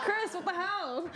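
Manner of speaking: slow, laughing what the hell; laughing mock outrage. Real, not acted. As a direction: scold through laughter.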